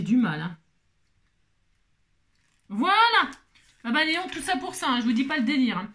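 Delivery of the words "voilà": voilà très haut et fort; voilà triomphant. Real, not acted. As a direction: crie sa victoire. Triomphe, collage enfin réussi. (voilà triomphal, fort et aigu)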